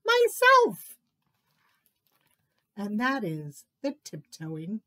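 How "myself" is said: high, loud punchline; one high, emphatic word; triumphant little tiger. A narrator's reading. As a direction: announce it proudly as the cub.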